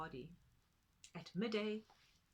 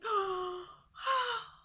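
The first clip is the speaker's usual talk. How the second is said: joyful gasp; 12 semitones higher, 10 dB louder than usual. Acted. Tutu overjoyed.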